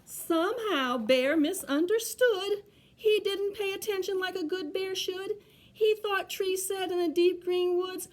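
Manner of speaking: high, sing-song picture-book reading. A narrator's reading. Reader tells children of Bear's mistake, story narration. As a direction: read the rhyme out with mounting suspense.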